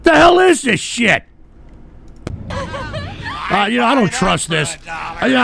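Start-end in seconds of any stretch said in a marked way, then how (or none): 0.0-1.2 exasperated, raised voice at the chat; real disbelief, pushed higher and louder; angry outburst. Real, not acted. Streamer snapping at trolling chat, angry disbelief. 2.5-3.7 sudden high laughing yelp; a burst of shocked laughter; surprised laugh shout. Real, not acted. Streamer reading chat, bursts into laughing yelp.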